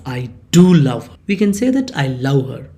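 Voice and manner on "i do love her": "do" punched loud, then a falling finish; bold, louder stress on "do"; emphatic DO. Shown as a demonstration. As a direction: hit "do" hard to insist on it. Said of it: "you stress that love".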